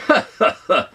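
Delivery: high, loud scornful ha ha ha; boss's loud mocking laugh. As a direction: laugh down at him like a sneering boss. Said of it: "The man laughed".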